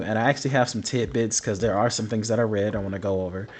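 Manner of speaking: ordinary talk, nothing marked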